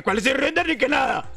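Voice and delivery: sudden high yelp; surprised outburst. Real, not acted. Sudden game reaction.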